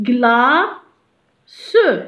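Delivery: "غلا سو" with a long pause before "سو"; split into syllables. Shown as a demonstration. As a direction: separate "غلا" from the final "سو" sound. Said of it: "سا سو برونونس سو".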